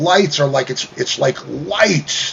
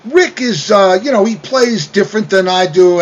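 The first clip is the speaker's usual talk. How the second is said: flustered reply; 8 semitones higher than usual. Retold or imitated. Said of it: "So I said".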